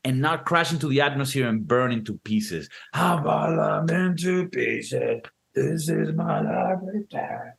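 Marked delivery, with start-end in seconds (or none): 2.9-7.5 very slow, fading into a whisper; slow dramatic breakdown down to a whisper; mock dying astronaut. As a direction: ham up the doomed pilot's last words.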